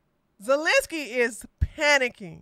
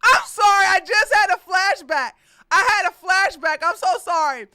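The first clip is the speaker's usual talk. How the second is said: laughing apology; 8 semitones higher, 8 dB louder than usual. Real, not acted.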